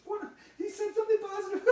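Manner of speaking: high, laughing squeal; hammed-up giddy disbelief; amazed delight. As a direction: squeal it in mock astonishment, breaking into laughter. Astonished at the positive remark.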